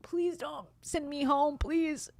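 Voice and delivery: high, slow begging plea; pleading. Retold or imitated. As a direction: beg them not to send you home, whining. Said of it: "I didn't say that out loud, but in my head".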